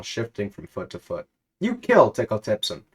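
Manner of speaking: ordinary delivery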